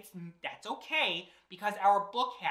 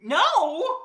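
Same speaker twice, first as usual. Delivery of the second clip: high, loud cry of disbelief; mock dismay; 7 semitones higher, 8 dB louder than usual. Reader learning she cannot stop reading, mock dismay.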